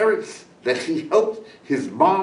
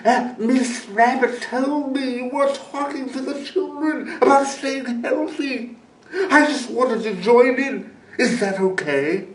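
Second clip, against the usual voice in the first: high, sneaky coyote asking to join in; sly coyote voice; 8 semitones higher than usual. A narrator's reading. Coyote asks the eagle to join, sly. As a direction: act innocent like a sly coyote.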